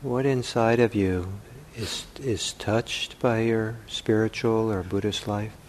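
unhurried meditation voice with long pauses; calm contemplative guiding, phrase by phrase; guided meditation. Acted. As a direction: softly offer questions, leaving silence between them.

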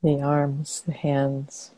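calm meditation guidance with long pauses between phrases; guided meditation. Real, not acted. Teacher guiding meditators to soften and relax the body, guided meditation. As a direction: guide them gently into relaxation, leaving long silences.

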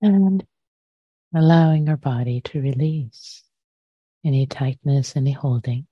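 unhurried meditation guidance with long pauses; guided meditation. Real, not acted. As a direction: guide listeners into the breath, leaving space after each phrase.

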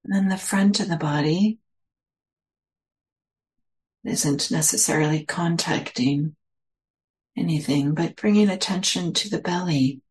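measured meditation voice, phrase by phrase; guided meditation. Real, not acted. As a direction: guide listeners into stillness, leaving space between phrases.

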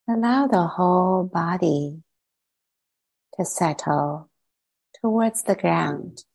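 calm, unhurried meditation guide with long pauses; gently measured meditation voice, settling phrase by phrase; guided meditation. Acted. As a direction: ease listeners into relaxing, leaving space after each phrase.

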